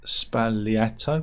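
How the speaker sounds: "Spagliato" said slowly, spahl-YAH-toh; "Spagliato" drawn out carefully; correct pronunciation. Shown as a demonstration. "Spagliato" demo of the Italian way, slow spahl-YAH-toh. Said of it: "is pronounced".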